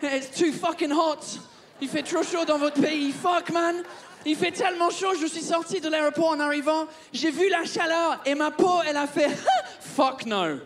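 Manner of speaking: put-on comic Englishman's accent in French and English; fake English accent. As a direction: grumble in a hammed-up English accent. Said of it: "je suis obligé de commencer avec un faux accent à la con".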